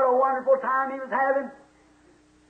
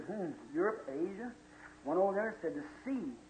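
much softer, low musing over a book; mumbled reading; 7 semitones lower, 16 dB quieter than usual. Acted. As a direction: murmur the place names to yourself. Old man reading geography book.

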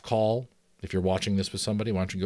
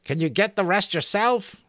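high, helpful little voice; obliging sentry; 11 semitones higher, 3 dB louder than usual. Acted. Sentry prompting the forgetful giant, high obliging voice. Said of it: "the voice said obligingly".

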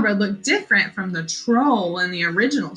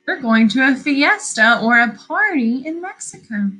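ordinary delivery, nothing marked

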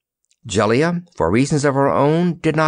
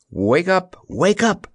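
high, urgent cry; 9 semitones higher than usual. A narrator's reading. Scarecrow pummels the sleeping wizard, crying out. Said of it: "cried the Scarecrow".

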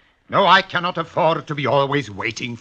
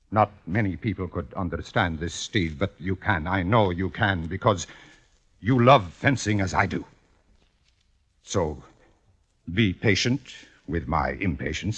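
tender confiding; 7 semitones lower, 4 dB quieter than usual. Acted. Maestro confides his illness.

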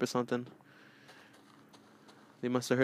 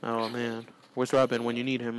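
normal talking voice

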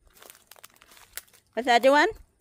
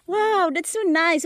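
high, louder, delighted; excited; 8 semitones higher, 4 dB louder than usual. Real, not acted. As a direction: bubble over with joy. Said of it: "I'm just get excited".

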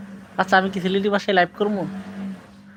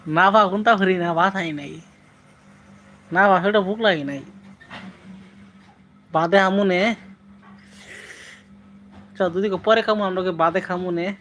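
Sylheti dialect. Shown as a demonstration. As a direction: play along in Sylheti dialect. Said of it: "Sylheti ভাষায় কথা বলতে". (সিলেটি টানে জবাব দেওয়া)

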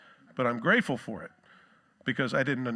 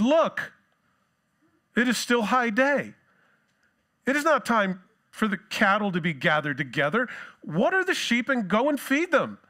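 bossy Jacob; 6 semitones higher, 4 dB louder than usual. Acted. Jacob bossing the shepherds.

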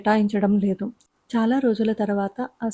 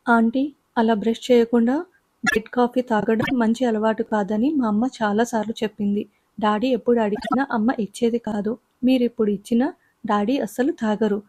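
plain reading voice

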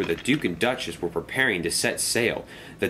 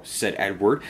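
plain reading voice

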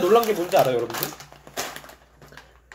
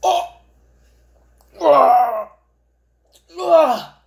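excited yell; 7 semitones higher, 4 dB louder, much slower than usual. Real, not acted. First bite of cereal.

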